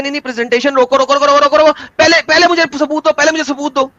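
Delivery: high, urgent repeated interrupting; mock insistent heckling. Debater imitating a listener halting a cleric's presentation, insistent heckling. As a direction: cut in over and over, demanding, as a mock heckler.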